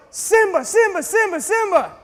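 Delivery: high, loud alarmed shouts; yelling. Retold or imitated. Villagers warning about a lion, loud high yelling. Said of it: "they yelled".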